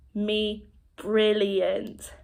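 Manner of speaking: very slow, stretched-out words; expressive sign demonstration. Shown as a demonstration. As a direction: draw out each sign for the learner. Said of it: "it's your facial expression that helps".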